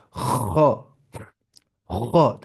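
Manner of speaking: "خا" with a long rasp before the vowel; scraping kha. Shown as a demonstration. Teacher repeating the sound for learners, scraping kha demo. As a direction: scrape the back of the throat on "خا". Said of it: "تھوڑی خراش کی آواز لانی پڑتی ہے".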